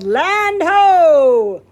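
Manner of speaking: loud, high lookout call; yelled. A narrator's reading. The boy spots the island from the mast, yelling. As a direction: shout it from the top of the mast. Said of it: "When Nick yelled".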